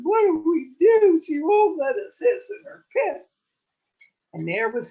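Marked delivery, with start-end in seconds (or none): 0.0-3.3 much higher and loud, hammed-up sobbing; crying wail. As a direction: wail like a bawling child. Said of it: "If they hadn't been crying so hard".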